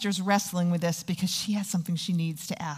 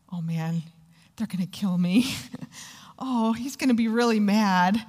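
ordinary talk, nothing marked

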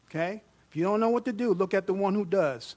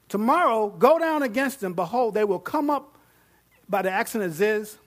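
higher and louder, preaching the prophet's command; fervent scripture preaching; 4 semitones higher, 5 dB louder than usual. Acted. Preacher reading the prophet's command to the congregation, fervent. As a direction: proclaim it like a battle order.

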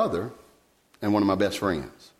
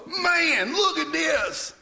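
much higher, slow, wide-eyed wonder; amazed discovery; much slower than usual. Retold or imitated. New believer marveling at the commandments, excited high wonder.

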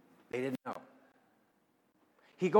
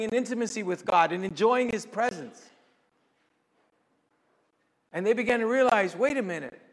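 higher, louder preacher's voice; fervent preaching; 6 semitones higher, 7 dB louder than usual. Real, not acted. Preacher pressing a point to his congregation, higher and louder. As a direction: preach it out with conviction to the room. Preaching at raised pitch and volume.

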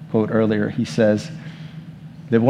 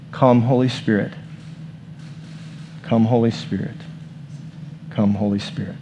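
calm invocation fading softer; prayer demo; 6 dB quieter than usual. Shown as a demonstration. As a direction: model the prayer, calmer each time. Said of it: "we're all gonna just say".

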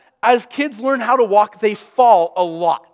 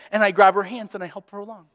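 ordinary delivery

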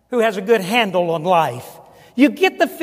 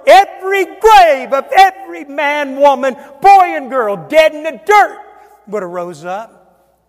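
loud, high, punched phrase by phrase; rousing preacher's climax; full-force preaching; 6 semitones higher, 8 dB louder than usual. Real, not acted. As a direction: hammer each word to the back of the church.

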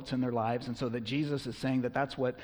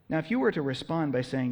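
normal talking voice

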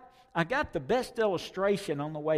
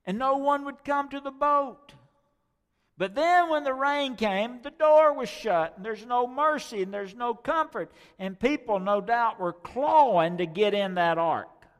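high, loud preaching build; fiery preaching; 9 semitones higher, 6 dB louder than usual. Acted. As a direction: preach it to the rafters. Preaching climax on the flood.

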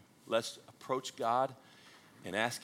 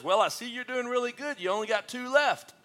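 much higher, laughing through the punchline; southern gentleman comeback; 12 semitones higher, 4 dB louder than usual. Acted. Old southern gentleman snapping back, high and laughing. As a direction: deliver it dry, then crack up. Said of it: "the old southern gentleman says".

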